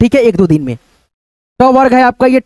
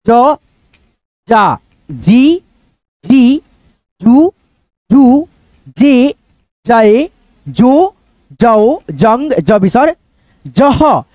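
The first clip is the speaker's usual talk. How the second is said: drawn-out, sing-song recital of each syllable; syllable drill; much slower than usual. Shown as a demonstration. Teacher reciting the ज syllable chart to students, sing-song. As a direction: chant the barakhadi one by one for pupils. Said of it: "ठीक है, फटाफट".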